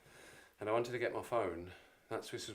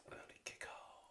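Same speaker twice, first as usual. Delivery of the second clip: whispered to himself; 15 dB quieter than usual. Real, not acted. Muttering to himself.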